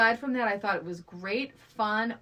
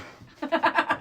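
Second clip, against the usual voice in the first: burst of laughter; 8 semitones higher, 7 dB louder than usual. Real, not acted. Laughs after mock order.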